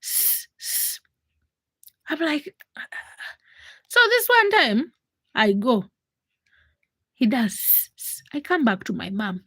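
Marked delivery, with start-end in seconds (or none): 0.0-1.0 unvoiced sss like calling cows; hissing call. Man at the shop hissing at a passing girl, sss call. 7.5-8.2 unvoiced sss like calling cows; hissing call. Man at the shop hissing at a passing girl, sss call.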